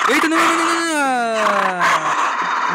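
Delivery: high, loud drawn-out no; panicked shout. Real, not acted. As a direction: yell it as you lose the fight. His dinosaur being killed.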